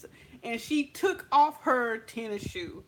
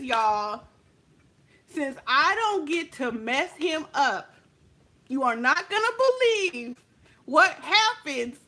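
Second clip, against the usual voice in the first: normal talking voice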